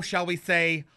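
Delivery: ordinary talk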